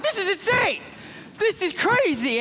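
high-pitched thrilled freak-out. Acted. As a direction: squeal it in disbelief. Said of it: "he's, like, freaking out".

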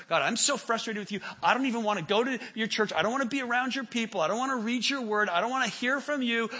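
loud, high, fed-up complaint at God; frustrated outburst. Acted. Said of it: "turn away from God and go".

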